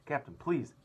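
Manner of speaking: loud, high sharp appeal. A narrator's reading. First officer trying to calm the captain, sharp loud appeal. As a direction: cut in sharply. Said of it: "the first officer said sharply".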